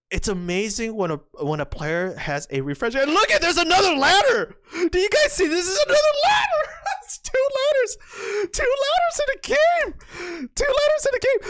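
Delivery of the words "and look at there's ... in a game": very high squeals with laughter; hysterical excitement. Real, not acted. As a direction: shriek in giddy disbelief. Spots a second ladder.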